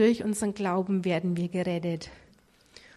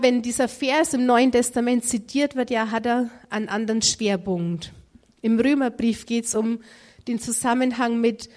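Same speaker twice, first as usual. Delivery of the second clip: ordinary delivery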